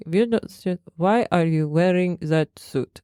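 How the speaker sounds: put-on shaky German accent slipping toward Russian; attempted German accent. German trainer confronting the boss, botched German accent. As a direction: try a German accent and miss. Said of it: "it's sounding Russian".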